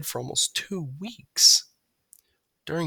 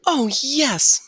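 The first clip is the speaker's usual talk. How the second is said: high bird voice; 6 dB louder than usual. A narrator's reading. Margalo voice.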